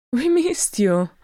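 high-pitched, eager outburst; blurting. A narrator's reading. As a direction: blurt it out as an overjoyed youngster. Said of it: "blurted Gimli".

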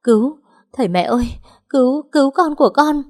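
high, desperate acted wail; wailing cry for help. A narrator's reading. Injured pregnant woman calls her parents to save her baby, wailing. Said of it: "gào lên khóc".

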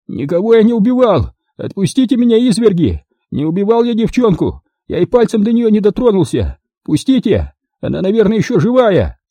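high-pitched, pleading protest; frantic denial. A narrator's reading. Pinned captive denies killing the girl, frantic. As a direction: protest desperately, voice pushed way up.

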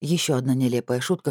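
usual speaking voice

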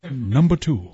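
Bob Shepard impression. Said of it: "It is kind of haunting".

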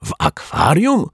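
high, drawn-out shocked question; stretched, incredulous high cry; astonished cry. A narrator's reading. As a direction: exclaim it in disbelief, pitch leaping up. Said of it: "krzyknęli chórem".

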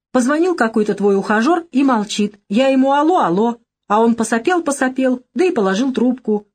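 comic grandmother imitation. A narrator's reading. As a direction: playfully mimic a grandmother reporting a phone call. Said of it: "так смешно изобразила свою бабушку".

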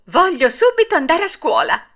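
high, loud eager puppet voice; a wooden boy bursting with eagerness. Acted. Puppet boy promising his father, eagerly and loud. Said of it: "disse Pinocchio al suo babbo".

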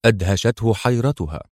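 ordinary delivery

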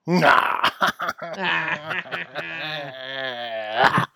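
loud laughter that keeps rolling; long real laugh. Real, not acted. Cohost loses it at the pianist punchline, long loud laugh. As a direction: crack up completely.

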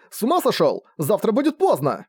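high-pitched outburst of dismay; alarmed protest. A narrator's reading. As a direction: protest in disbelief, pitched well up. Brother alarmed at the delay. (встревоженное возмущение высоким голосом)

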